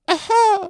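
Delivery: sudden high, loud "aha" laugh; loud burst laugh. Real, not acted. Laughs at own show sign-off.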